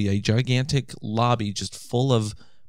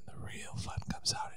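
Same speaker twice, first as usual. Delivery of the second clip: whispering; 17 dB quieter than usual. Acted. Teasing about night fun.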